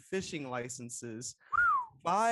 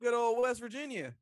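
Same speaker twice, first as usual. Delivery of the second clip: higher Southern country twang; West Virginia accent; 7 semitones higher than usual. Acted. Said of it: "is that not a good West Virginia voice".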